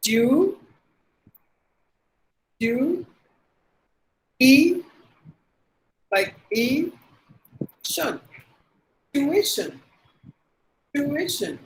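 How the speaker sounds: tuition in three parts, tyu-I-shun; correct pronunciation. Shown as a demonstration. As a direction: sound the middle i in tuition. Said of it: "That's how we have to pronounce".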